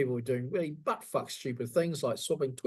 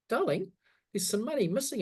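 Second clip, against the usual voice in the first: lightly voiced wife; 7 semitones higher than usual. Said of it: "the wife goes".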